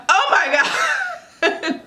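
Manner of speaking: high, long drawn-out cry into laughter; startled shock. Retold or imitated. Mother as baby lands on her, startled. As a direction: act out a squealing, laughing shock.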